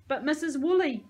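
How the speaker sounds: high, loud protest; yelled. A narrator's reading. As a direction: yell to cut through her chatter. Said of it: "I yelled".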